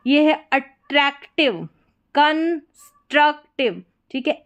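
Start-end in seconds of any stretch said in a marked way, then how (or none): 0.5-1.7 attractive slowly as a, trak, tiv; broken into syllables. Shown as a demonstration. Teacher models a practice word, syllable by syllable. 2.1-3.8 constructive with gaps, con, struc, tiv; slow, syllable by syllable. Shown as a demonstration. Teacher models a practice word, slow and split.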